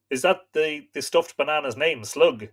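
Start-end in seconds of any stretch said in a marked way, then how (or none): none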